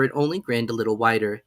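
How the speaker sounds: usual speaking voice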